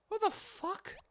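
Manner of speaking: shocked. Real, not acted. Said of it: "reaction to the dialogue".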